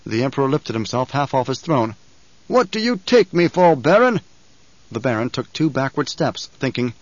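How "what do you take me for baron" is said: high, loud, scornful demand; roaring tyrant king. A narrator's reading. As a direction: tower over him with fury. Emperor rages at the Baron.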